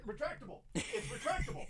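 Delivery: distant off-mic voice; away from the mic. Shown as a demonstration. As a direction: speak from far off the mic. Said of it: "take the mic away from my face".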